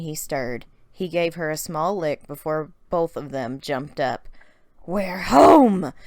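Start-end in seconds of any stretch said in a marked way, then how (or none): 4.8-5.9 loud, happy cry of relief; girl shouting with joy on waking in her own room; joyful realization. A narrator's reading. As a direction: burst out that you're home at last. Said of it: "she realized".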